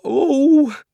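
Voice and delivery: sudden high gasping "Oh!"; children jolted by a fright; startled cry. Acted. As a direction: cry out in shock, high and loud. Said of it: "Both children said".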